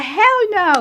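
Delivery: high, loud, laughing; laughing refusal. Retold or imitated.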